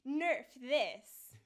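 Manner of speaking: loud, punched game-hero catchphrase; short, forceful taunt from a game heroine; D.Va impression. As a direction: belt it out like a battle cry, words spaced apart. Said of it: "give us a bit of diva".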